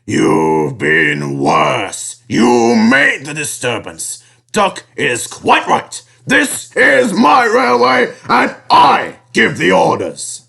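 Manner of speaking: slow, rising indignant scolding; stern rebuke. A narrator's reading. Fat controller tells the engines who gives orders, sternly. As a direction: lay down the law. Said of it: "he thundered".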